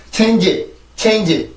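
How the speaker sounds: "change" read as Korean "체인지", full final i; 잘못된 한국식 발음. Shown as a demonstration. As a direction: give "change" separate Korean syllables. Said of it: "이렇게 발음이 되는 게 아니고요". ("change"를 '체인지'로 끝모음까지 또박또박)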